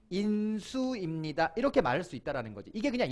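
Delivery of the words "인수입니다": slow model answer. Shown as a demonstration. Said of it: "뭐라고 부를 수 있더라고".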